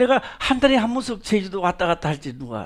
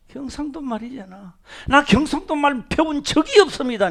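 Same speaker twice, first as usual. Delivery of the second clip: normal talking voice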